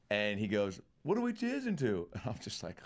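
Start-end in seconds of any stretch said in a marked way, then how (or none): 1.0-2.1 very funny, pitched much higher; mock celebrity tossing off a compliment; high amused older man.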